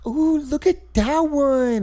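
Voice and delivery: put-on high, slow little-girl coo; high, slow, awed little girl. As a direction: imitate a small girl in a princess costume. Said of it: "she's just like".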